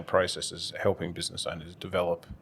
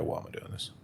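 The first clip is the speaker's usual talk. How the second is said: despairing question; 9 dB quieter than usual. Retold or imitated. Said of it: "days where you go".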